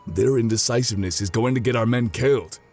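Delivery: frustrated. Acted. Veteran complains to spymaster about the nobles' delay, frustrated. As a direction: let the veteran's frustration push the voice up.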